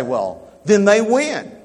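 loud, high-pitched, drawn-out objection; mock protest. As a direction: play the objector, raising pitch and volume, slowly. Voices listener's objection to preacher.

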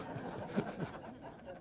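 breathy, quiet chuckle; a small embarrassed laugh under the breath; soft laugh. Real, not acted. Pastor chuckling after admitting yelling at football games, quiet airy laugh.

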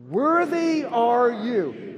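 loud, slow, high proclamation. Shown as a demonstration. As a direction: draw it out loudly as a call.

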